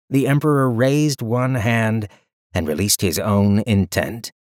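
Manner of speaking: plain reading voice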